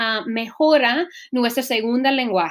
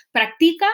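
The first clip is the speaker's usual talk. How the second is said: práctica mis-stressed as prac-TI-ca, higher; 5 semitones higher, 4 dB louder than usual. Shown as a demonstration. Teacher testing the penultimate rule, stress on TI. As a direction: push the weight of práctica onto the middle syllable. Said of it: "la mayor fuerza debe caer en la penúltima".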